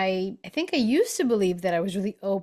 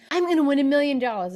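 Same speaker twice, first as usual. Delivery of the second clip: higher, louder, fast excited claim; excited boast; 6 semitones higher, 5 dB louder, faster than usual. Retold or imitated. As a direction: blurt out the big win, thrilled. Friend boldly claims lotto win.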